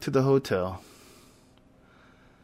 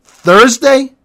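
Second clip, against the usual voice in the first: high loud incredulous Thursday; shocked disbelief; 10 semitones higher, 12 dB louder than usual. Acted. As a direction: yelp it in total disbelief. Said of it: "I must not have heard you correctly".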